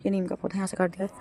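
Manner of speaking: ordinary delivery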